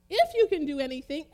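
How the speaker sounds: loud, emphatic retort; retorting exclamation. Retold or imitated. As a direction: throw it back with force, like an exclamation. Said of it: "exclamation point".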